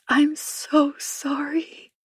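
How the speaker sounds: slow, high, barely voiced apology; whisper. A narrator's reading. Anna apologises to Louis, slow broken whisper. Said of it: "I drop my voice so low".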